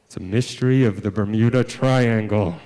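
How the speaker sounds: low, ominous storyteller voice; mock-mysterious. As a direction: intone it like a spooky legend. Mock-spooky answer to why.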